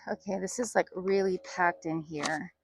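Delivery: speaking through a face mask; muffled by a mask. Real, not acted. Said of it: "Sorry if I sound muffled".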